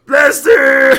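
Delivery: loud high-pitched yelp of the name; high squeaky shout. Acted. Streamer answering himself in a high squeaky shout. Repeated in a second voice.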